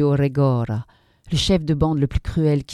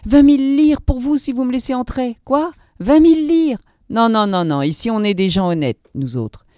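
higher, louder voices haggling; indignant woman and begging man; indignant refusal; 7 semitones higher, 6 dB louder than usual. A narrator's reading. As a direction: voice the quick refusal scene. Woman refuses, man offers money.

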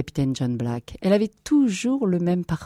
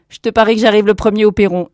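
loud, higher excited dare; playful challenge; 6 semitones higher, 10 dB louder, faster than usual. Acted. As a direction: dare a brother to race. Challenge to a race.

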